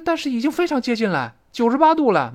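high-pitched exclaimed warning; acted alarm. Acts out first speaker's worried reply.

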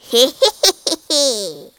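loud witch's laugh; witch's cackle. A narrator's reading. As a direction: cackle loudly in mockery.

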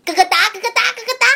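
high, loud hen clucking; angry clucking hens. Acted. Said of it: "母鸡们听了全都生气地叫了起来".

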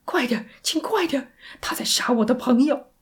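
urgent, strained high plea; crying out. Acted. Sandy pleading with operator to hurry, crying out. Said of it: "山迪叫".

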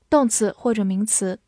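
usual speaking voice

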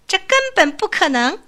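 high, loud indignant cry; little mouse refusing to believe the elephant is stronger; displeased shout. A narrator's reading. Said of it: "很不高兴地喊了一声".

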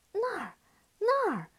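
high, loud fake-excited call; mock eager pointing. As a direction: pretend you see it. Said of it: "小梅故意乱指".

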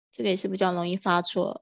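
usual speaking voice

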